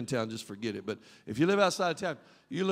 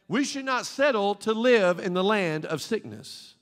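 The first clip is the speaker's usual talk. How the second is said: usual speaking voice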